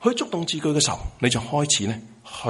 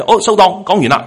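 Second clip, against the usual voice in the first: higher, louder mock sign-off; mock offhand prayer sign-off; 8 semitones higher, 12 dB louder than usual. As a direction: wrap up a prayer like a finished errand, loudly. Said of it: "係好似一張購物單". (模仿草草收場嘅祈禱，大聲啲)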